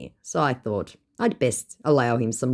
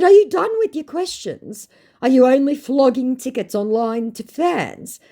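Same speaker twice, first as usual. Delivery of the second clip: much higher and louder, indignant; mock fed-up; 12 semitones higher, 6 dB louder than usual. Woman to scammer, theatrically indignant. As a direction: fire it off like an outraged aunt.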